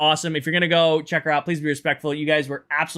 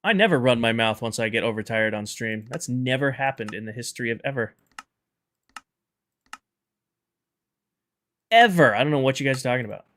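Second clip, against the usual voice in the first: sassy; 3 semitones lower than usual. Acted. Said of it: "So sassy?".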